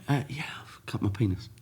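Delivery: hushed, breathless confession at the desk; embarrassed whisper. Retold or imitated. To the receptionist, an embarrassed whisper. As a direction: whisper it so nobody nearby hears. Telling reception about his injury.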